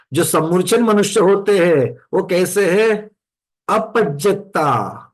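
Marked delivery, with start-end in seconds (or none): 3.7-5.0 अपज्जता with long, drawn-out vowels; prolonged vowel. Shown as a demonstration. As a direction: stretch अपज्जता slowly, holding the long vowels. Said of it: "वहां पर दीर्घ उच्चारण होता है".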